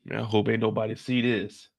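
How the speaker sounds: calm yourself after a scare; shaken. Acted. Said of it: "had me scared".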